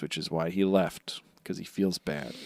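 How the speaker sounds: soft unvoiced aside; whispering. Real, not acted. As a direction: whisper the explanation.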